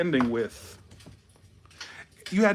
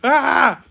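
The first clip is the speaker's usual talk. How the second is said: loud cry; 10 semitones higher, 9 dB louder than usual. Real, not acted. Outburst after the screamed no.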